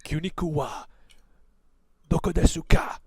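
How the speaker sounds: high, emphatic Japanese battle cry; yelled Japanese. Acted. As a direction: call it out in showy Japanese. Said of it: "I yell in perfect Japanese".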